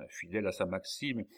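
ordinary delivery

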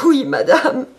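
high, loud sobbed answer; sobbing. A narrator's reading. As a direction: sob the yes out. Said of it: "Rosalie sanglota".

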